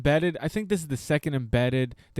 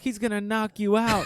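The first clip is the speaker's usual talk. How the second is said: put-on a light British kid's accent, pitched higher; child's British accent; 7 semitones higher than usual. Bisping's son answering his dad, light British accent. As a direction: sing it out like a cheeky British boy. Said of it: "In his British accent".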